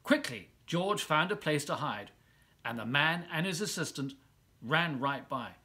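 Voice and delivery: ordinary delivery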